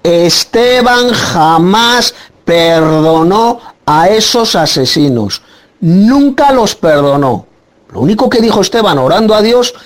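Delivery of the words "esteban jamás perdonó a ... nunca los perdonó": jamás and nunca drawn out slowly, each word weighted; slow, insistent preacher's emphasis; slow emphatic stress. Real, not acted. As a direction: hammer the point home slowly. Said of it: "lo quiero recalcar, lo repito".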